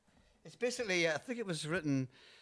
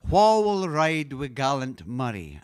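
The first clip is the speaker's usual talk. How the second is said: slow Scots line, louder; Scottish dialect line; 5 dB louder, slower than usual. Shown as a demonstration. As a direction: speak it in slow broad Scots. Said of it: "the first line is".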